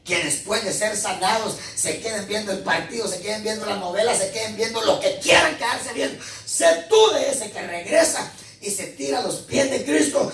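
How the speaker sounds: building, high-pitched preaching; exhortación de predicador. Real, not acted. Preaches exhortation, rising intensity. (prédica que va subiendo de tono)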